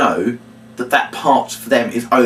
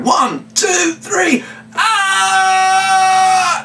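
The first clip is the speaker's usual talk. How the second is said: count-in and shout; much slower than usual. Shown as a demonstration. Entertainer leading the girls' shout, yelling. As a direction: whip up the girls.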